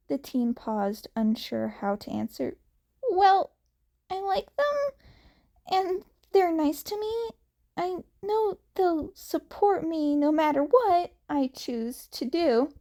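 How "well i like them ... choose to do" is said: put-on higher, eager boyish voice; earnest young hero. Izuku tells Tomura he trusts his friends, earnest and higher. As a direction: sound like a sincere teen defending his friends.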